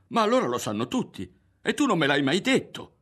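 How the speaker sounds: loud, worked-up complaint; indignant reproach. A narrator's reading. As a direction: accuse him in hurt astonishment. Berto reproaches Ario.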